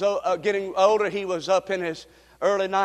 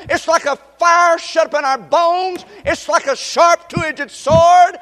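raised, emphatic preacher's delivery; fervent preaching; 9 semitones higher, 8 dB louder than usual. Acted. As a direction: preach it with mounting conviction, driving each phrase home. Preaches with mounting force.

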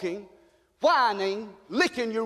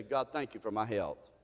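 low, much softer thank-you to God; hushed prayer; 12 semitones lower, 10 dB quieter than usual. Retold or imitated. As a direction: drop to a humble murmur.

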